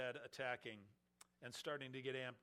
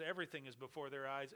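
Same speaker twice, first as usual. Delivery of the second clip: ordinary talk, nothing marked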